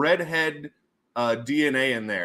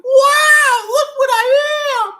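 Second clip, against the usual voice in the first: very high, loud hammed-up squeal; mock amazement; 12 dB louder, much slower than usual. Imitating customer reading DNA results, mock amazed shout. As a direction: cry out in exaggerated wonder.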